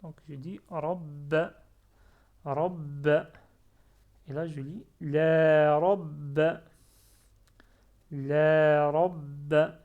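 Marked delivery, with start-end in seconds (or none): 0.7-3.1 "rabb" appuyé, b redoublé puis a bref; avec fatha. Shown as a demonstration. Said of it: "là, il y a fatha". 5.0-9.8 "la rabb" très fort, "la" longuement tenu, b retenu; étirer "la" avant d'appuyer le b de "rabb"; la prolongé. Shown as a demonstration. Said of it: "j'ai prolongé".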